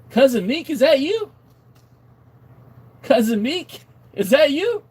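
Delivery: high, loud, rising question; excited calling out. Pretend. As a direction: call out to a long-lost relative. Calls out to cousin in chat.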